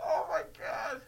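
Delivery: high, soft, voiceless gasp of words; breathless from laughing. Real, not acted. Host recovering from the pun, breathless with laughter. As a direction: wheeze it out after laughing hard.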